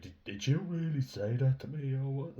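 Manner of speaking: high, loud, outraged mock girl voice; hammed-up indignant squeal; mocking dramatic young woman. Mocking young women who make everything a drama, outraged squeal. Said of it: "It's like, they make every situation into a drama".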